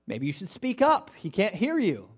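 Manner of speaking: teasing Elijah taunt; mocking. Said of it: "He starts making fun of them".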